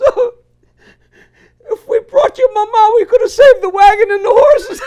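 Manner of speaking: high, loud mock sobbing, breaking into laughter, Italian accent; crying Italian farmer. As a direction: wail the punchline in a hammy Italian accent. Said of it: "He says".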